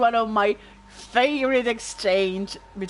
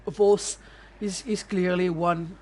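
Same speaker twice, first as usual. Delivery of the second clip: put-on playful Game of Thrones Northern accent; mock Northern accent. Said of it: "I won't do this accent anymore".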